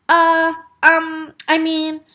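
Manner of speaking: halting, drawn-out fillers; flustered hesitation. A narrator's reading. Father caught out by Taya's question, flustered. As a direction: stall while searching for words.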